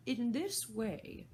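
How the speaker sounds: slow, high Hatter lead-in. Acted. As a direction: draw out the line before a song. Hatter voiced in story.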